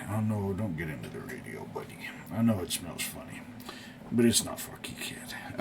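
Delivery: soft cooing to a curious cat; talking to the cat. Real, not acted. Owner gently shooing his cat from the radio, softly. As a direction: gently warn the kitty off, voice going soft.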